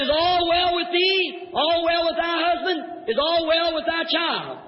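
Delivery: drawn-out, high preaching questions; prophet's solemn questioning. Acted. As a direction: call each question out slowly, pitched high. Prophet calls his questions to the woman.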